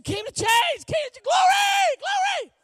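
very high, loud praise shout; ecstatic shouting. Retold or imitated. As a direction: scream it out as if overcome with praise. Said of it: "I'm shouting".

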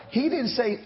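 ordinary delivery

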